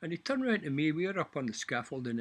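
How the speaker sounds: ordinary talk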